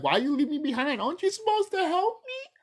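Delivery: high mocking whine of someone abandoned; whiny abandoned plea. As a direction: whine it like a left-behind fool. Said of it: "you're gonna be like".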